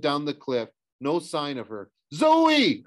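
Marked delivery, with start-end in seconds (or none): 2.1-2.8 high, loud drawn-out call; searching shout. A narrator's reading. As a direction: yell for someone lost down a cliff. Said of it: "I shouted".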